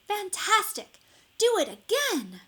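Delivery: high, loud, cheering; excited shout. A narrator's reading. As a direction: cheer him on to do it again. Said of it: "But Elizabeth shouted".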